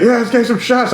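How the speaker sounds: high-pitched mock drunk party guy; trashed club owner. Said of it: "like, trash".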